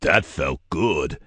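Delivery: slow, savouring giant's growl. Acted. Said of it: "growled the giant".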